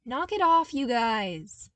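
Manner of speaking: loud, high shooing rebuke; scolding call. Acted. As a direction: tell them off from above. Luke scolding clones from stairs.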